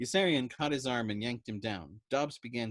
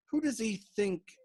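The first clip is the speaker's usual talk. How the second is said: sniffing; 11 semitones higher, 4 dB louder, slower than usual. A narrator's reading. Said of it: "sniffing spasmodically".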